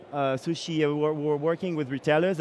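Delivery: ordinary talk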